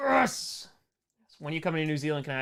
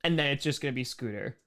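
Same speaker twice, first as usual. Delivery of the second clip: ordinary talk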